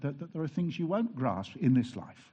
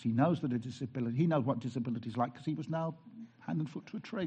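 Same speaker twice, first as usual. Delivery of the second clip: normal talking voice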